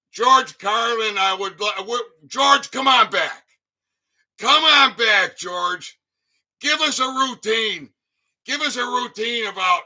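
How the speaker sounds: loud, high pleading call. Real, not acted. Begging George Carlin to come back for a routine, loud call. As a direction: call out to George as if he could hear. Calling out to dead comedian.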